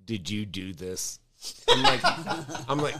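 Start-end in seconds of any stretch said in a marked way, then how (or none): 0.0-1.2 lower, softer accusing friend on the phone; lightly voiced suspicious friend; accusing Jeff. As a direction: friend calling at dawn, mildly voiced accusation. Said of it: "Jeff calling me going".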